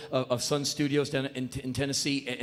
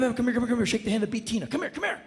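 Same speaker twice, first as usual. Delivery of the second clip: ordinary delivery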